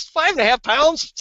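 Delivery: mock disbelief from a friend; incredulous. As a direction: act stunned by the amount. Dan's disbelief retold.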